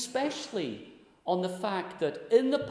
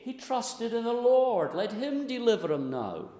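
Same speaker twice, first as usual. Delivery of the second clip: mocking taunt; 7 semitones higher, 3 dB louder than usual. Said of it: "people did mock him and laugh at him".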